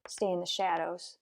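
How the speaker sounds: a quiet order while tailing someone; hushed. Acted. Detective tells his partner to keep out of sight, hushed.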